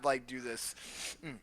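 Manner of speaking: normal talking voice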